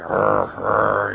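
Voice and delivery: low, loud growling rrr; dog growl imitation. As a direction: growl like a small dog biting an ankle. Imitating a little dog hanging on.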